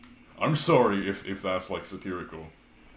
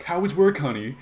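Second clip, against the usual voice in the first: high, loud put-on mother; mock mom voice; 8 semitones higher, 7 dB louder than usual. Mock mom asking her child about work at dinner, high put-on voice. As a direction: imitate a chirpy mom's question. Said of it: "their moms at dinner, and they ask".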